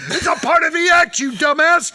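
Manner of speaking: high, exasperated scolding; mock angry manager. Manager scolds soundman for pulling the mic, mock angry. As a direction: chew out the clueless soundman.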